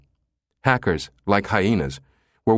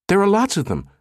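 high, urgent cry; cried; 11 semitones higher than usual. A narrator's reading. Soshi crying out to Susan about the bugs, urgent. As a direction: insist it with alarm. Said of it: "Soshi cried".

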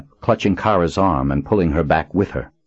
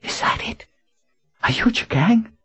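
stunned whisper. A narrator's reading.

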